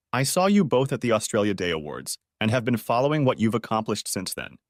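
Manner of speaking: usual speaking voice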